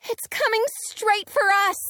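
high, loud urgent warning; alarmed call. A narrator's reading. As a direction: shout it over the wind. Calling over storm noise.

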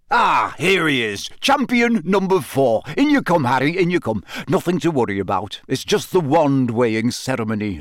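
hearty, high-pitched welcome that settles into reassurance; Bagman. A narrator's reading. Official welcoming the fourth champion, jovial. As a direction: boom a jolly greeting, then ease off.

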